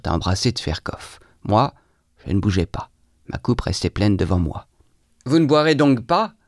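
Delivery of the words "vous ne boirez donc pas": raised, higher-pitched challenge; impatient yell. A narrator's reading. Trudolyubov to the one not drinking, impatient yell. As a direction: demand it, losing patience. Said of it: "hurla Trudolyubov, perdant patience".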